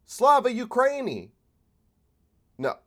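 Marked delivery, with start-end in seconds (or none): 0.1-1.3 high, loud mock patriot cheer; mocking dumb conservative. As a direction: sneer it like a gullible flag-waver. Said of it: "What does a dumb conservative think?".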